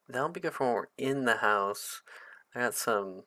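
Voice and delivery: ordinary delivery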